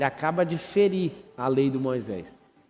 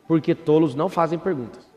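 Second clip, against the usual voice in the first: usual speaking voice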